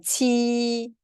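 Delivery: 치 high, long and level; word reading in tone. Shown as a demonstration. Teacher reading a word for students, level 치. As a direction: stretch 치 on one high pitch. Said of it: "제가 한번 읽어볼게요".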